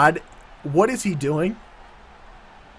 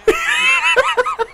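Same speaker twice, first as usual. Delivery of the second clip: high shrieking laugh. Real, not acted. Burst of laughter at move.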